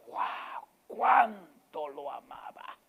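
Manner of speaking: a hushed gasp of wonder; awed crowd. Pretend. Onlookers see Jesus weep, hushed and amazed. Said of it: "la gente cuando lo vio llorar dice".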